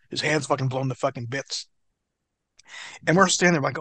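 ordinary talk, nothing marked

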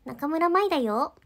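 slow, lightly voiced anime girl; half-step into the cute anime voice; a touch of cartoon cuteness; a hint of anime voice. Shown as a demonstration. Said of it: "アニメキャラみたいな面白い声".

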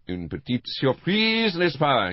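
high, slow Poirot-style French; French phrases. Acted. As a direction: purr it out in French, pleased with himself. Poirot greets narrator in French.

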